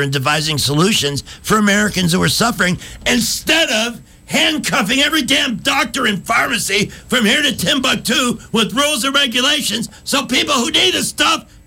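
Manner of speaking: from calm statement to full campaign yell; building to a shout. Acted. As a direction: start steady, climb to a shout. Mock campaign speech building up.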